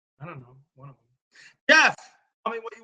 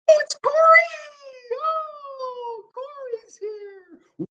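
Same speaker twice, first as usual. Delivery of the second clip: excited greeting; 7 dB louder, much slower than usual. Real, not acted. Excited greeting of arriving viewer.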